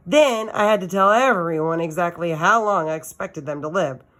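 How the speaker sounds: ordinary delivery, nothing marked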